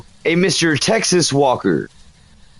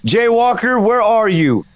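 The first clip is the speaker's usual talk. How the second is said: calling out; 5 semitones higher than usual. Real, not acted. Calling out to the ghost.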